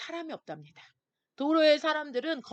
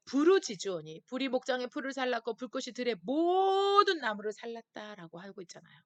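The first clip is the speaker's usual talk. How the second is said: ordinary talk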